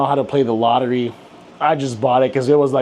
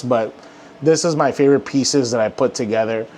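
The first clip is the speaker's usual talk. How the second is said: ordinary talk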